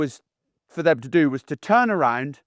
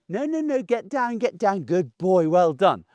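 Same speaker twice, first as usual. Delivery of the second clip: soft indulgent owner. Shown as a demonstration. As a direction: gently fuss at the dog. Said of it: "You go".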